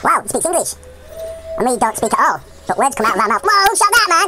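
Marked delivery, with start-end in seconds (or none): 3.5-4.3 high, loud startled yelp; startled shout. Real, not acted. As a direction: jolt up as the shot rings out. Sudden gunfire in the game.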